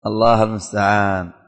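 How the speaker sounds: ordinary talk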